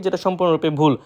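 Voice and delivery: ordinary talk, nothing marked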